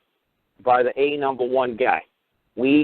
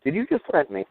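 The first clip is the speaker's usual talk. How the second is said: higher, playful incredulous question; pitched-up, grinning mock surprise; smiling mock delight; 6 semitones higher than usual. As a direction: ask it with delighted disbelief. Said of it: "I just smile like crazy".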